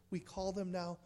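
soft whispered aside. Acted. Preacher mocking polite names for death, whispering. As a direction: let it fall to a hush.

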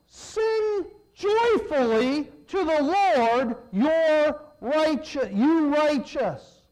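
loud, slow proclaiming; fervent reading. Acted. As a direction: proclaim it like a call to worship. Reading psalm with fervor.

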